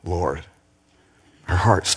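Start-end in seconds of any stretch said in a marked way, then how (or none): 0.0-0.4 soft echo. Acted. Preacher echoes woman's reply.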